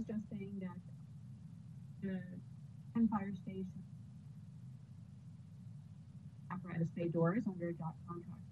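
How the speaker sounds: fading in and out; bad connection. Real, not acted. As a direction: voice drifting away from the mic. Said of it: "you get soft in and out".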